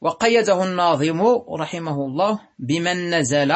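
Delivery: normal talking voice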